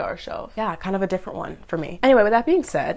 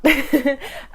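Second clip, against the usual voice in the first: real laugh; 8 semitones higher, 5 dB louder than usual. Real, not acted. Laughing at her own bad expectations.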